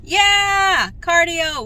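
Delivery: loud high yeah and cardio; excited cheer. Real, not acted.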